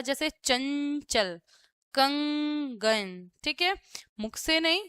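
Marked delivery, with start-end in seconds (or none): 0.3-3.3 "चंचल, कंगन" with the nasal sound held long; nasal anusvara. Shown as a demonstration. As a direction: slowly hum the nasal in "चंचल, कंगन" through the nose. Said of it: "उनका उच्चारण नाक से होता है". ("चंचल, कंगन" में अनुस्वार नाक से खींचकर)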